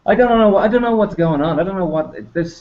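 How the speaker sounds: normal talking voice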